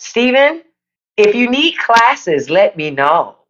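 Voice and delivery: ordinary talk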